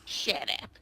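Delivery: whispery little goblin. Acted. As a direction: hiss it like a goblin. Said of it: "that's your Rick Snot voice?".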